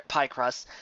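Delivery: ordinary delivery, nothing marked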